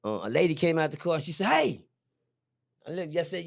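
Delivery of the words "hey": a raised hey, higher and louder; mother's call. Retold or imitated. Angry mother calling to the boy in the car, higher. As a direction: call out sharply to get his attention.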